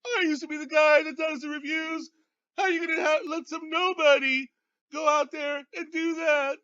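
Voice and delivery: high, loud, shrieking jealous outburst; outraged shrieking rant. Retold or imitated. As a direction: shriek it like a jilted lover, crying out. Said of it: "And Sasquatch went".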